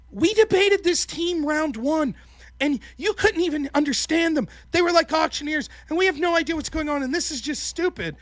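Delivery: high-pitched, hammed-up upset partner; mock half-crying complaint. Upset debate partner complaining about round one, mock half-crying. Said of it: "half crying and shit".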